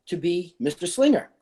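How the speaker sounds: ordinary delivery, nothing marked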